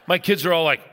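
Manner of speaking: ordinary talk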